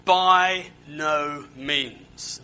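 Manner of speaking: By no means drawn out very slowly, each word weighted; slow, heavy stress on no and means, the final word; emphatic no. Acted. Preacher to congregation, Paul's emphatic denial, slow and heavy. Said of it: "And the answer is emphatic".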